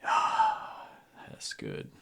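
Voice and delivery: put-on soft, hushed drunken approval; satisfied murmur. Drunk has just downed his mid-tier drink, hushed and satisfied. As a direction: savour it under your breath after a swig.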